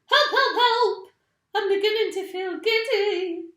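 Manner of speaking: very high, very loud cry for help; screaming. A narrator's reading. Missus Twit feels giddy on the ceiling, high loud screams. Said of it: "screamed Missus Twit".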